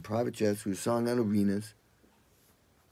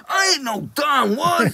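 high, loud, slow indignant retort; indignant singer; 9 dB louder, slower than usual. Retold or imitated. Singer denying he's the producer, loud and offended. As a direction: bark it back, offended. Singer snaps at mistaken identity.

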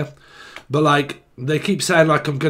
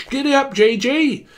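very high mock giddy-up call; mock roadman; 10 semitones higher than usual. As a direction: squeal it like a cheeky roadman.

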